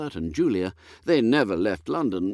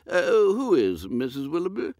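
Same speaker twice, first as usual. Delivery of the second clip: put-on high, dithering old lord, higher and fluttery; a woolly, absent-minded aristocrat, higher pitched; vague old earl; 10 semitones higher, 4 dB louder than usual. Lord puzzling over dinner with his butler, high and dithery.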